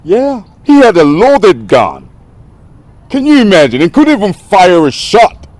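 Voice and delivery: high-pitched, exasperated tirade; indignant, incredulous outburst; outraged rant. Acted. Ranting about a fired bodyguard.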